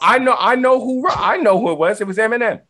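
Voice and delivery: joking guess for the quizmaster; mock eager answer. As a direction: blurt the wrong answer proudly.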